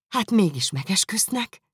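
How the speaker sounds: high, wondering cry; amazed exclamation. A narrator's reading. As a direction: marvel aloud at the news. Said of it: "kiáltotta Elizabeth". (magas, csodálkozó felkiáltás)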